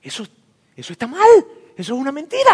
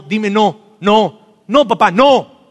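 firm no; 3 dB louder, slower than usual. Shown as a demonstration. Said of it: "dilo fuerte".

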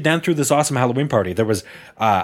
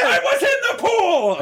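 very high, hammed-up protest; sitcom impression. Friend performing on "do it again", high mock protest. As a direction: wail it like a sitcom character, half laughing.

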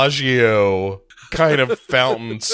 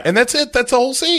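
much higher, exasperated punchline; mock exasperation; 14 semitones higher, much faster than usual. Real, not acted. Host mocking a pointless scene, high exasperated. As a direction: squeak out the disbelief.

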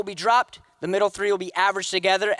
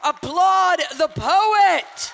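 slow, high, louder call to the crowd; cue applause; 8 semitones higher, 4 dB louder, much slower than usual. Real, not acted. Host urging audience to cheer the poet, drawn-out rally call. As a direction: stretch it out and rally the room.

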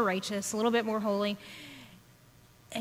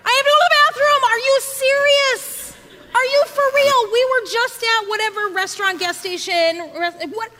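much higher, loud, disbelieving shriek; exasperated yell; 9 semitones higher, 6 dB louder than usual. Retold or imitated. Mom yelling back at child needing the bathroom, exasperated. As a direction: blow up at the child in outrage. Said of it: "A child then yells from the back seat".

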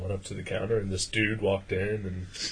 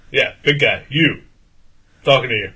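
loud, higher, insistent jabs; imitated heckler; 3 semitones higher, 12 dB louder than usual. Stranger to the big guy ignoring him, loud pestering. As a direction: imitate a pushy stranger singling someone out.

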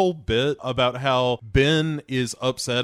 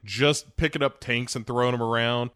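normal talking voice

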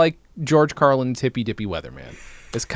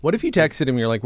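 usual speaking voice

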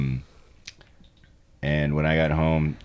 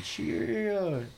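put-on toddler voice; 13 semitones higher, 9 dB quieter than usual. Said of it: "when he goes".

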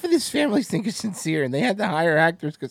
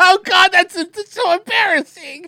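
cringing laughter; 13 semitones higher, 6 dB louder than usual. Real, not acted. Host cracking up at how embarrassing it is, laughing cringe. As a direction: squeal it out, helpless with laughing.

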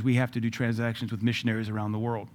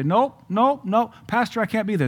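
whiny excuse voice; 8 semitones higher than usual. Retold or imitated. Mimics an excuse-making congregant.

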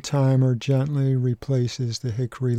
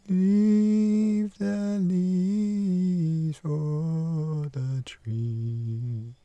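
walnut tree voice; 6 semitones higher, 3 dB quieter, much slower than usual. Acted. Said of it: "That there's the walnut and the hickory".

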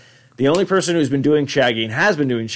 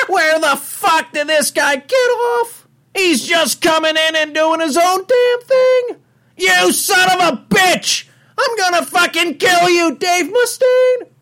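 outraged, very high cartoon slacker; hammed-up high cartoon fury; Shaggy; 4 dB louder than usual. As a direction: rant furiously in a squealing cartoon voice. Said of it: "I have to hear the Shaggy".

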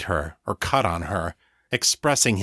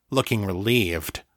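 plain reading voice

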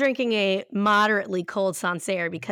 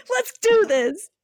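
mock hype; 11 semitones higher, 5 dB louder, slower than usual.

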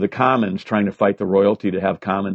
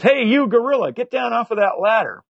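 much higher mock shout up the ladder; joking yell; 12 semitones higher than usual. Young cop teasing a man in a gorilla suit, joking yell. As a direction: call up teasingly like a cop having fun. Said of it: "jokingly yells out".

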